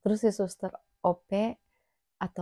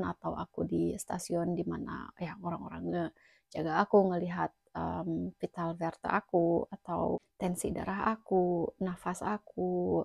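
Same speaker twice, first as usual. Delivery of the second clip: usual speaking voice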